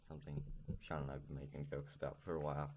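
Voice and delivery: ordinary talk, nothing marked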